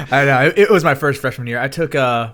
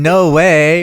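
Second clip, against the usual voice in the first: put-on higher, louder Valley Girl no way; 4 semitones higher, 8 dB louder than usual. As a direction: gush it like a California valley girl. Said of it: "the Valley Girl is like".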